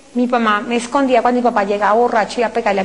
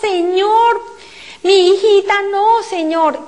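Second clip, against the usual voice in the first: father crying out; 7 semitones higher, much slower than usual. Retold or imitated. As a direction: plead for his daughter in anguish. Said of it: "Él pone sus manos y grita y dice".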